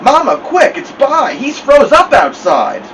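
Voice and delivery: high, loud cry of alarm; urgent alarm. A narrator's reading. Boy bursts in to get help for his brother, urgent alarm. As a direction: raise the alarm breathlessly fast.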